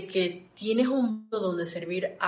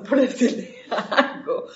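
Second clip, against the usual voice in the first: louder, laughing through the words; 5 semitones higher, 8 dB louder than usual. Real, not acted. Joking about the first glass ever made, laughing. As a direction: laugh at her own silly remark. Laughs at her own joke.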